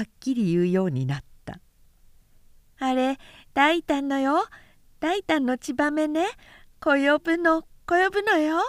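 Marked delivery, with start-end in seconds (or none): 2.8-8.6 put-on high, lisping toddler voice; high childish babble; small child. A narrator's reading. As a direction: chirp it like a little boy proud of himself. Toddler chatters about swallow.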